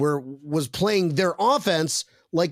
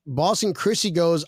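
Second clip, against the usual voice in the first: ordinary talk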